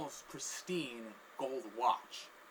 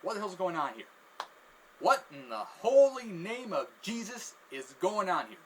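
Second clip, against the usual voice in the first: raised, higher voice, building louder; exasperated outburst; 6 semitones higher, 8 dB louder than usual. Acted. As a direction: exclaim in disbelief, building to a shout. Cashier exclaims at the scene.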